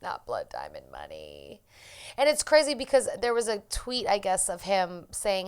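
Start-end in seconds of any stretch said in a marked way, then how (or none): 0.0-1.6 whispered, slow echo; slow, breath-only murmur of disbelief; hushed aside. Real, not acted. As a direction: drop to a near-voiceless whisper. Amused aside echoing co-host's phrase.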